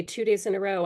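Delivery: ordinary delivery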